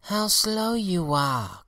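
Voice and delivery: high, slow cry of the lily. A narrator's reading. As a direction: stretch it out, high and loud.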